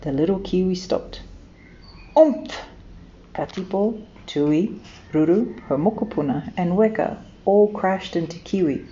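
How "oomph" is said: high, loud bumped grunt; crash grunt. A narrator's reading. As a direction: grunt at a sudden bump. Friends crash into Kiwi.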